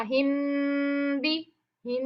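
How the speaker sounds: हिम बि said as separated syllables, the म of हिम hummed into बि; isolated syllable demo. Shown as a demonstration. Teacher breaking the sound down syllable by syllable for students. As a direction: split हिम and बि apart and hum the म. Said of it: "ये sound आनी चाहिए".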